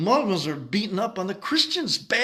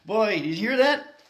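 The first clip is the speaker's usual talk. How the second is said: ordinary talk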